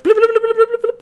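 high, rapid imitated bomb blips; freaking-out bomb beeps. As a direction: rattle off frantic high bleeps like an alarm. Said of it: "the bomb is, like, freaking out".

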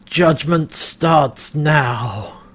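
slow, otherworldly possessed voice, drawn out; slightly different voice. Retold or imitated. Pilot taken over, announcing judgment in a slow alien voice. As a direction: let something else speak through you. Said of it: "in a slightly different voice".

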